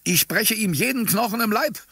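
high-pitched, raging threat; hammed-up bloodthirsty cry; belligerent shout. A narrator's reading. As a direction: shout it, pitch shooting right up. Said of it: "rief ich mit geballten Fäusten".

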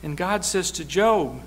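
usual speaking voice

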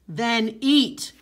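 commanding. Acted. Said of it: "answered the Great Spirit".

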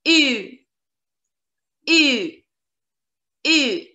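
U said with rounded lips, French u. Shown as a demonstration. Teacher drilling the French U sound. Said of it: "the letter U is U".

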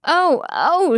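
high, swooping delighted cry; excited exclamation. A narrator's reading. Mama bursts in to see the bride, excited exclamation. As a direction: exclaim with pitch leaping up. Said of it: "she exclaims, so excited she jiggles".

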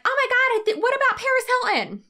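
very high, loud mock gasping outrage; mock objector. Imagined viewer objecting about Paris Hilton, high mocking squeal. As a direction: squeal it like an alarmed commenter. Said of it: "Just in case anyone's like".

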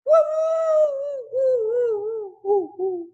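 high, loud howling woos; dog crying. Acted. Lucy calling to the dog in the mirror, high howls. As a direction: cry out like a lonely dog. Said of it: "Lucy cried to the dog in the mirror".

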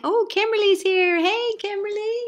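high, loud happy hello; light up as a friend walks in; excited greeting. Real, not acted. Teacher greets Kimberly arriving late, high and delighted.

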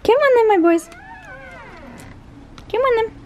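high, sing-song dog call; calling pets. Real, not acted. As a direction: coax the dogs along in a high voice. Calling the dogs along.

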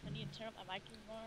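fast, barely audible mumble; faint, hurried remark; very quiet. Real, not acted. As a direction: rush it out very softly. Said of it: "You sound really quiet".

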